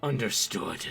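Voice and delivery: low, cold devil reply; low demon voice. Acted. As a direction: answer like an obedient fiend.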